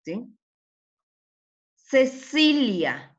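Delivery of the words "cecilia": CECILIA slow and higher, middle syllable stretched; sounding out. Shown as a demonstration. Teacher reading the word so pupils judge its sound, slow.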